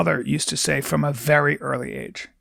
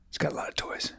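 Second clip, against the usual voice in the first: whispered aside; 14 dB quieter, faster than usual. Real, not acted. Repeated wry aside.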